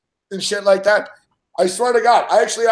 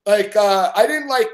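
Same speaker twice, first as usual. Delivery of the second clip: ordinary talk, nothing marked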